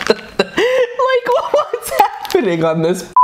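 laughing through the words; cracking up while talking. Real, not acted. Laughing at trippy picture.

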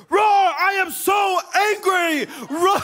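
slow, high mock lion growl-talk; hammed-up child's lion rage; full-voice toddler roaring. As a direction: imitate an angry little boy roaring his words. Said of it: "he's just full voice screaming at her".

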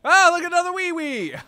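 very high loud exclamation; thrilled at spotting another one; excited squeal. Real, not acted. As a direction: squeal it with glee.

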